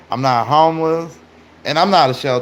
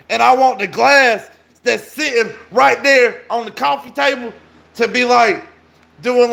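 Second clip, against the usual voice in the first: excited hype; 8 semitones higher than usual. Pretend. Hyped bedroom instructions.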